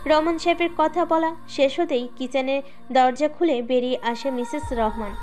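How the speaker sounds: plain reading voice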